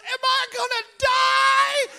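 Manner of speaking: put-on high, loud, drawn-out wail of a toddler; terrified child. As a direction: act out a child screaming in panic. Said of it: "The blood was terrifying him".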